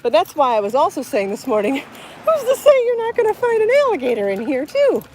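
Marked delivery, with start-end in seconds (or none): 2.2-5.0 much higher, louder mock-fearful voice; mock worried squeak. Vlogger joking to viewers about alligators, high mock-fearful voice. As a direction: pitch it way up like a jumpy kid scared of gators.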